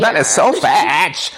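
slow creaky vocal fry. Pretend. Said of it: "I'm gonna vocal fry".

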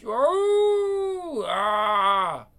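high swooping howl; high creature wail. Acted. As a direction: wail up high then drop. Creature cry.

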